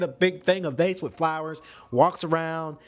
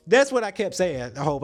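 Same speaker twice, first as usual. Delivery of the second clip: ordinary talk, nothing marked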